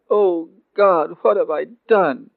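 loud, high anguished cry; stricken, anguished Joseph; remorseful moan. A narrator's reading. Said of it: "Joseph moaned".

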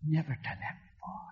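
softly whispered in awe. Real, not acted. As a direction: breathe it out in wonder. Awed repeat of mother's wonder.

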